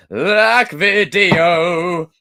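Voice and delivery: loud, long drawn-out holler; shout-out. Pretend. Streamer gives a viewer a shout-out, loud drawn-out holler. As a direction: stretch it out into a big shout. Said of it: "can I have a shout out?".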